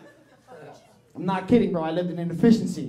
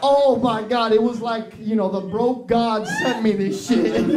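normal talking voice

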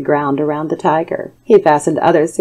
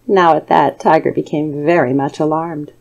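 ordinary delivery

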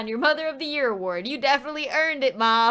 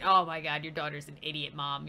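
low, soft dry aside; quiet, deep-pitched grumble; muttered aside; 11 semitones lower, 6 dB quieter, faster than usual. Real, not acted. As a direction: mutter it low under your breath. Muttered comment, then repeated louder.

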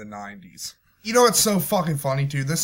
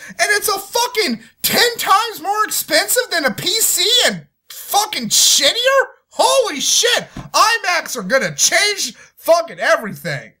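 high, loud mocking sarcasm; real scornful ranting; sarcastic rant; 13 semitones higher, 4 dB louder than usual. Real, not acted. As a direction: sneer at the hype. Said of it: "but I'm so fucking angry".

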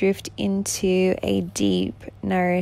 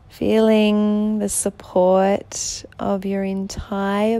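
guided relaxation. Acted. Guiding a listener in bed through breathing before sleep, soothing meditation voice. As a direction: gently lull the listener towards sleep, unhurried.

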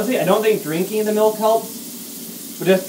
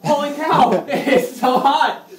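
laughing; 9 semitones higher, 4 dB louder, much slower than usual. Retold or imitated. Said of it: "you're gonna be like".

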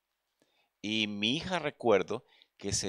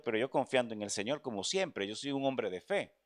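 ordinary delivery

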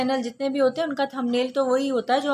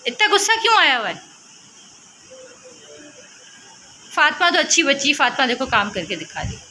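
high coaxing mom voice; 8 semitones higher, 3 dB louder than usual. Real, not acted. Mother coaxing her angry daughter to draw, high and sing-song.

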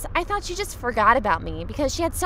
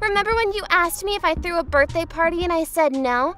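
put-on gloating girl voice; 7 semitones higher than usual. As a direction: rub it in proudly.

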